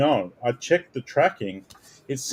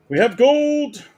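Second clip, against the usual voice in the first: sudden high, loud cheer at a gold pull; high-pitched excited announcement of a rare card; 13 semitones higher, 6 dB louder, slower than usual. Real, not acted. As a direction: shout it like you just hit gold.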